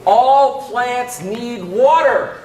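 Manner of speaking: all plants need water said slowly, water landing heavy; slow stressed line. Shown as a demonstration. Teacher cueing the helper with water slowly. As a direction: draw it out and hit water as a cue. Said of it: "every time I say the word water".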